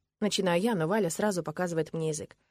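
usual speaking voice

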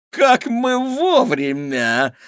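high, slow gloating villain voice; gloating exclamation. A narrator's reading. Gavint bursts into the room, slow high gloating. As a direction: relish catching them at the right time. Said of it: "злорадно воскликнул Гавинт".

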